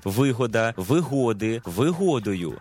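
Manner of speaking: вигода, вигоди, вигодою all stressed on ГО; stress kept on second syllable. Shown as a demonstration. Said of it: "наголос зберігається у тому самому складі".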